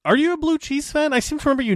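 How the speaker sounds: high, incredulous teasing; surprised question. Real, not acted. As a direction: squeak the question up high as if caught off guard. Teasing question about blue cheese.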